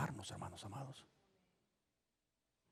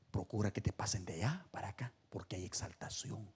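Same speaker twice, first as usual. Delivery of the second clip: soft, unvoiced whisper of advice; hushed whisper; 2 semitones lower, 8 dB quieter than usual. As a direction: lean in and whisper the counsel. Jesus's advice voiced softly.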